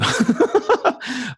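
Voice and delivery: real, high-pitched burst of laughter. Real, not acted. Podcaster amused by his dad's threat, high laugh. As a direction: laugh high after the impression.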